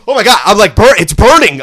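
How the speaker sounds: mock screaming that it burns; hammed-up burning yell. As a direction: overplay the pain like a brat in church. Retold panic, kid hamming it up.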